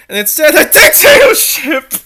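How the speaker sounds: laughing loudly through the word; bursting out mid-sentence. Real, not acted. Laughing at dictatorship line.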